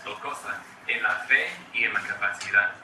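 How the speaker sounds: ordinary delivery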